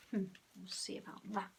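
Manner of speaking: quick sly whisper. A narrator's reading. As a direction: plot it under your breath, fast. Henry's sly thought.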